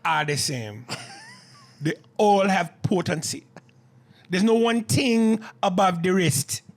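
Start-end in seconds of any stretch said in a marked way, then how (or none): 1.8-6.6 high, loud put-on Jamaican accent. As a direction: boast in a hammed-up Jamaican voice. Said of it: "my accent sounds pretty goddamn good".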